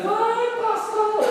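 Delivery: cantarolado bem agudo e lento, zombando; deboche cantado. Retold or imitated. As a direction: cantar a provocação em tom alto. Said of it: "E ela dizia". (esposa zombando do marido, cantarolando agudo)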